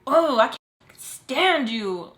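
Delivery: slow, drawn-out disgust. Retold or imitated. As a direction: drag it out with exaggerated loathing. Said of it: "She is just disgusted with me".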